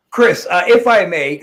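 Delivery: loud, formal bid to interrupt; loud interjection. Real, not acted. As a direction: raise your voice to take the floor. Cutting in to get the floor.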